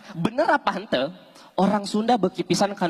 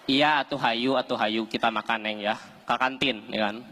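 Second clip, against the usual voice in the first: low lightly voiced boyfriend; 11 semitones lower, 4 dB quieter than usual. Boyfriend agrees to eat with his girlfriend, low voice. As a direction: answer her low and easy. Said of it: "Kurang, kurang".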